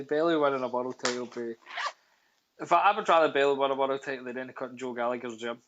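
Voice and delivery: normal talking voice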